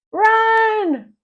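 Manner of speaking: high loud yell; cried out. A narrator's reading. George shouts to Harold to flee, high loud yell. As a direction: shout it as a sudden alarm. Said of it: "cried George".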